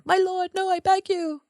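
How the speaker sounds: high, soft begging voice; acted high-pitched plea for mercy; pleading. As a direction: plead as a cowering servant. Wormtail begs his master for mercy.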